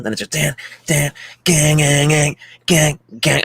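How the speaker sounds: imitating heavy riff. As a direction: chug out the riff with your voice. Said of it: "acousticy intro with the guitar".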